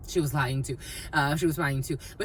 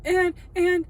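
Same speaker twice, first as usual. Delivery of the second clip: higher, louder gossipy voice; imitating the friend; 9 semitones higher, 4 dB louder than usual. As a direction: play the friend spilling the tea. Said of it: "and she's like".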